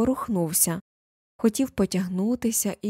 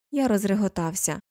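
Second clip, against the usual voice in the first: plain reading voice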